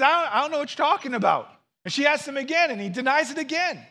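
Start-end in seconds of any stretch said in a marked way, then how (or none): 0.0-1.5 fast, high, flustered denial; Peter's panicked denial. Retold or imitated. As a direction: blurt it out like a cornered man, fast and high. Peter denies knowing Jesus.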